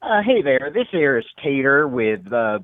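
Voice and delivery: folksy Southern caller drawling his introduction; country Southern service-tech voice; southern accent. Pretend. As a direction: put on a slow Southern twang. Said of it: "Why does everyone have a southern accent?".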